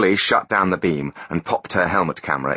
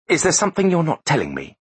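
higher, pressing demand; 11 semitones higher than usual. Acted. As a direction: insist on an answer, pitched up. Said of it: "she demanded".

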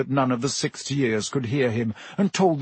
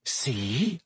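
softly spoken, pointed question; quiet; 6 dB quieter than usual. A narrator's reading. Ron makes his point to his friends, quiet. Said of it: "said Ron quietly".

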